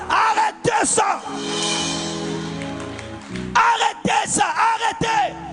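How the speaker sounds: ordinary talk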